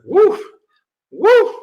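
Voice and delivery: high, loud mock exclamation of being blown away; impressed woof. The interviewer reacting to his bold words, high loud woof. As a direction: blow out a stunned "woof".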